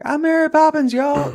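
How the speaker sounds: high, bright mock Yondu quote; quoting Yondu. Retelling Yondu's famous line, high and hammed-up. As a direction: pitch it way up for the silly line. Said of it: "you go from, like".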